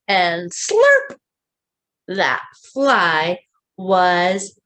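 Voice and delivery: slow, expressive picture-book reading; slow storybook read-aloud; reading to children. A narrator's reading. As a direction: draw the little ones into the story.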